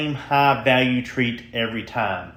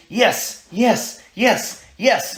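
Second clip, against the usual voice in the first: high, bright, excited yes; high happy praise; 8 semitones higher, much slower than usual. Shown as a demonstration. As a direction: cheer the dog on with each yes. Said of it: "My voice is going to change".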